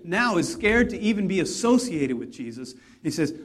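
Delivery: ordinary talk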